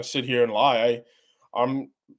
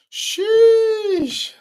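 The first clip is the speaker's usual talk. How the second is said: awed sheesh; 6 dB louder than usual. Real, not acted. Awed reaction to big saw.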